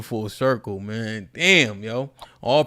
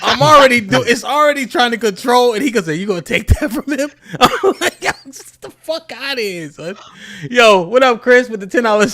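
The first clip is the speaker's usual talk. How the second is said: excited, laughing, high; 8 semitones higher, 6 dB louder than usual. Real, not acted. Laughing retelling of chat antics.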